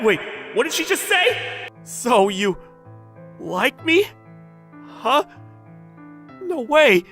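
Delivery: high, incredulous outburst; floored and disbelieving; stunned disbelief. Acted. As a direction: reel from a confession, voice leaping up. Stunned by her confession.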